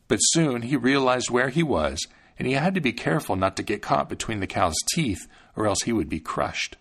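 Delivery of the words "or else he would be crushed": usual speaking voice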